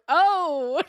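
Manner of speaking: high awkward "oh" with a laugh; a stunned crowd's "oh"; mock shocked gasp. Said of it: "everyone just went like".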